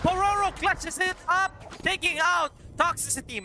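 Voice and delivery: high, loud clutch call; esports caster. Acted. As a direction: shout out the winning play. Calling the winning clutch.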